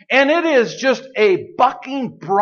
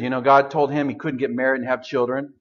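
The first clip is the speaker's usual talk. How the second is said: ordinary talk, nothing marked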